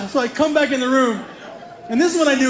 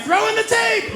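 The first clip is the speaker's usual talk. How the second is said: high-pitched eager call; excited demand; 11 semitones higher, slower than usual. Retold or imitated. Acting out demand for the tape.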